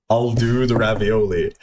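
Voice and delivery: slow, measured mock order; imitated diner's order. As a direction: lay the order out slowly, deliberately. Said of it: "Aiden fucking says".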